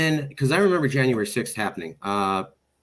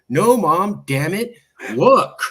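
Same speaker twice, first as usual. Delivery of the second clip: raised, louder retort, acted out; exasperated son; 5 semitones higher, 7 dB louder, much slower than usual. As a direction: insist over a nagging mother, punching each phrase. Retold exasperated reply to mother.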